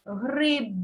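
гриб with a clearly released voiced b; careful гриб with a ringing final b; voiced final consonant. Shown as a demonstration. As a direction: sound the б at the end of гриб as b, never p. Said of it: "Вимовляємо так".

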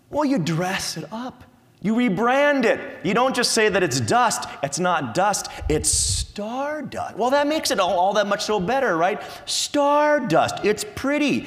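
preacher mocking the stardust idea with high, loud sarcasm; sarcastic mockery. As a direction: sell dust as something pretty, scornfully. Mocking the rebranding of dust.

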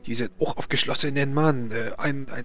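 put-on stammering Frenchman, heavy French accent; nervous French nobleman voice.

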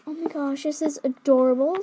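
ordinary delivery

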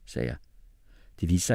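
usual speaking voice

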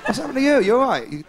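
a driver playing to the passengers; joking bus driver. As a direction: tease her for the whole bus to hear. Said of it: "he went".

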